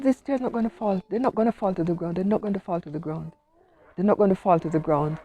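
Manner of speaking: soft, quick repeated vow; quiet resolve. Retold or imitated. Woman refusing to cry, soft quick vow to herself. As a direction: mutter it over and over to steady yourself. Vowing to hold tears back.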